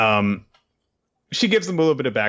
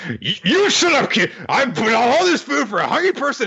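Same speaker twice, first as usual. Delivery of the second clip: much higher, louder, bossy granny voice; scolding old woman; 6 dB louder than usual. Retold or imitated. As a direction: bark at the stubborn kid like a fierce grandma. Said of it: "and she's like".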